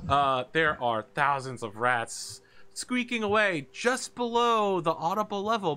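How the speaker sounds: ordinary talk, nothing marked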